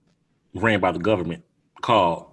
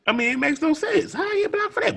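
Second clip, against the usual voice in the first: disbelieving, raised pitch; incredulous, higher voice. Real, not acted. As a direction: ask it in outrage. Outraged rhetorical question.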